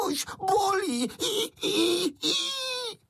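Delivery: very high squealing cry of pain; water goblin screaming. A narrator's reading. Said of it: "vodník křičet".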